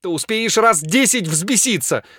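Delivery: high, loud, anguished plea; frantic, distraught entreaty; desperate begging. A narrator's reading. Frantic patient begging for cure.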